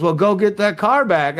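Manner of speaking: bossy mom voice; imitating mom.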